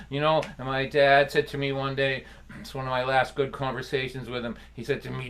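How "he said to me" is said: ordinary delivery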